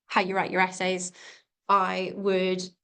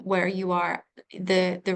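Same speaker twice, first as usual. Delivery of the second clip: ordinary delivery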